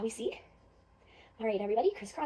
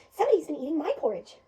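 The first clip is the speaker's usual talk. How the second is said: loud, lilting mother bear voice, pitched up; Mama Bear; 3 semitones higher, 7 dB louder than usual. Acted. Mother bear finding her porridge eaten, loud and indignant. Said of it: "Mama Bear comes over and says".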